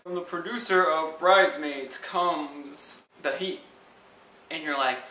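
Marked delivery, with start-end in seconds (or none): 0.0-3.6 slow, booming movie trailer announcer. Retold or imitated. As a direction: read it slow like a dramatic trailer voice-over. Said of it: "these trailers will say something like".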